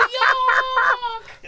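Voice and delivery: very high, loud New York retch; retching New York accent. Acted. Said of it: "That vomitous sound".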